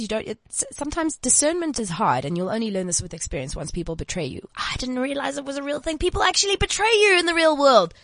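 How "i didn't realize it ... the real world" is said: mock naive surprise. Mocking her naive former self.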